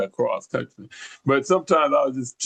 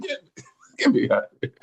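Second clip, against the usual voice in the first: high, fast, laughing alarm; high and fast, laughing in retold panic; panicked laughing plea; 12 semitones higher, faster than usual. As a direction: squeal it out between laughs. Fleeing the driver's advance.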